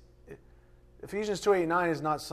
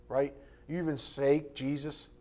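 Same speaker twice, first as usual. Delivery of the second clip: usual speaking voice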